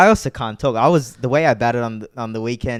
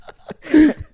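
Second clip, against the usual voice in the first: loud high laugh bursts out; high burst of laughter; 6 dB louder than usual. Real, not acted. Cracking up at being hauled before the coals, loud high laugh. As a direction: crack up at the joke.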